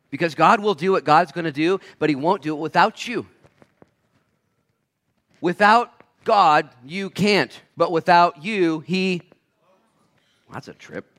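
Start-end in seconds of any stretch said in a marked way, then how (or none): 0.1-9.2 high, emphatic delivery with weighty phrases; impassioned preaching. Real, not acted. Preacher telling the church God wants them, impassioned. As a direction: press each phrase on the listeners.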